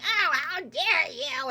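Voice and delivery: high, loud, slow outraged cry; hammed-up indignation; the hag furious at the mirror. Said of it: "And then she's like".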